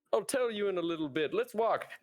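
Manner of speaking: goose voice; honking voice. Acted. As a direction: honk the words like a goose. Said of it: "My goose voice is back".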